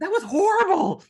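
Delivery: high, loud, overplayed anguish; mock anguished cry. Author groaning to her mentor, high and loud mock anguish. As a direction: wail it with comic despair. Telling mentor how it felt.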